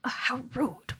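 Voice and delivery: breathless whisper of mock offence; whispered aside. Character grumbling to herself about the glare: whispered aside. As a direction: whisper it as a huffy little aside.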